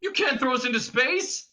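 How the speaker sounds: high-pitched protesting yell; Ford yelling. A narrator's reading. Prisoner objecting to being ejected, yelling. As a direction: yell in protest at your captor. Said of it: "yelled Ford".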